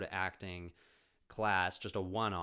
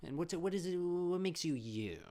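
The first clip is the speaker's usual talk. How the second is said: pretentious acting teacher; 7 semitones higher than usual. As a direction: draw it out like a grand Hollywood guru. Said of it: "this really intense, pretentious Hollywood, uh, teacher".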